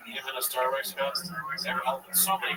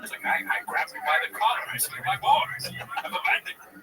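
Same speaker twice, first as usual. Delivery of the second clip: Day-Lewis impression. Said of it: "Daniel Day-Lewis talking".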